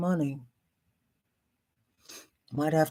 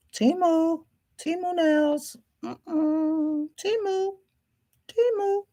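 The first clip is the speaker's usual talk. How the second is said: high sing-song; 11 semitones higher, slower than usual. Acted. Singing out Temu in a high voice.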